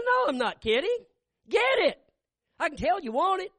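high, loud, insistent wife. Retold or imitated. Wife insisting to husband that he buy it, high and loud. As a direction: press him hard in a high voice.

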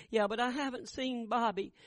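high, pleading mother's voice; frail mother pleading. Retold or imitated. Dying mother asking her children for Bobby, high and pleading. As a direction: plead for the missing son, feverish.

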